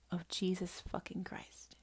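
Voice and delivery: hushed sermon whisper. Real, not acted. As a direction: whisper it like a secret truth.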